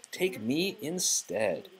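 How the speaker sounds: slow, high, resolute; slow sincere offer. Acted. Belle offering herself for her father. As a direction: offer yourself quietly. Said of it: "Belle said".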